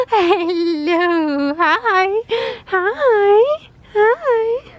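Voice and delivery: high, loud delighted squeals of hi; gasp, then squeal hello at the dog; thrilled high puppy talk; excited gasp and greeting. Real, not acted.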